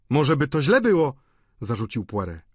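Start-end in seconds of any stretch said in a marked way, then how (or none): 0.1-1.1 higher, louder objection; sprzeciw Poireta. A narrator's reading. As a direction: object in a raised voice. Poiret nieśmiało oponuje. (podniesionym głosem, z zastrzeżeniem)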